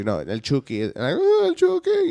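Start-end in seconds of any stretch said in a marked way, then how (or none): 1.2-2.1 shrill high panicked squeal; mock panic. Scared kids panicking about El Chucky, high mock shriek. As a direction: squeal like a terrified kid.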